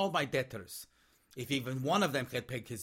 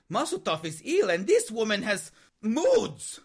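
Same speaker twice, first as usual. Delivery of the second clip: wailing in exasperation, louder and higher; exasperated cry; 6 semitones higher, 6 dB louder than usual. Acted. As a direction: cry out at the injustice. Exasperated outburst.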